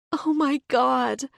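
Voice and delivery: high, slow wail through tears; sobbing into Zane's lap; crying out. Acted. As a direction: let it break out of her. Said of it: "I cry".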